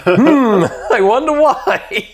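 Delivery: high, laughing sarcasm; sarcastic mock wonder. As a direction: play dumb with a snicker. Mocking the boss's clueless question.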